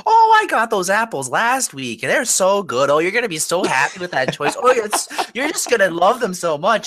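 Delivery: high-pitched, gushing apple enthusiasm; gushing stranger. Retold or imitated. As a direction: gush like an overexcited shopper. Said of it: "this lady ... was like".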